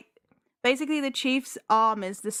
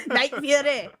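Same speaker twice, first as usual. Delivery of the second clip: raised, giggly attempt at a Scottish cry; playful Scottish accent, higher pitched; mock Scottish accent; 4 semitones higher, 4 dB louder than usual. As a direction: echo the Scottish yell, smaller. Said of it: "in a Scottish accent".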